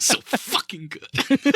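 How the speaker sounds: high, drawn-out hammed-up groan of pleasure, very slow; mock blissful moan. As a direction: ham up the ecstatic massage moan. Mimics blissful massage moan.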